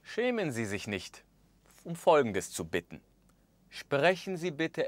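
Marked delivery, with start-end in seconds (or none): none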